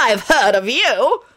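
suspicious. A narrator's reading. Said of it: "John muttered suspiciously".

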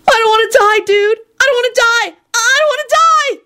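put-on very high, mounting childish wail; panicked wailing. A narrator's reading. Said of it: "repeatedly wailing".

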